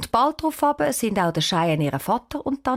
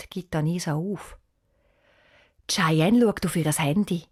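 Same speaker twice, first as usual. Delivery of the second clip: ordinary delivery, nothing marked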